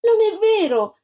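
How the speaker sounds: high, loud protest; a little girl crying foul at the top of her voice; child's indignant shout. A narrator's reading. Girl shouting at the lying child, high and loud. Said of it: "gridò Antonella".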